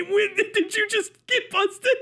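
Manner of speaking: normal talking voice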